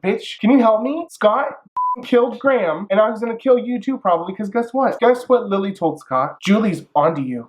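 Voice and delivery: urgent plea. Pretend. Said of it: "I would've been like".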